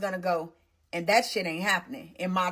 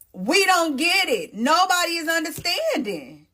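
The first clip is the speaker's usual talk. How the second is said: exasperated; 9 semitones higher than usual. Real, not acted. Telling viewers nobody understands him, exasperated and high. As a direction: stretch it out, fed up.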